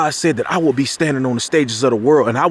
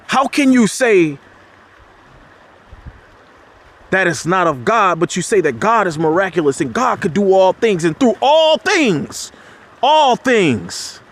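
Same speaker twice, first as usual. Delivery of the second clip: impassioned preacher pushing up high; fired-up sermon voice; preaching; 6 semitones higher than usual. Acted. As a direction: challenge the congregation, rising to a peak. Preaching rebuttal, voice rising high.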